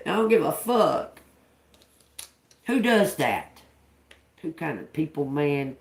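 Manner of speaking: ordinary talk, nothing marked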